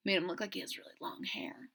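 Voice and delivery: fast whispered aside. Real, not acted. Storyteller whispering about soggy quills to kids. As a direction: hush it out quickly. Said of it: "whispered aside".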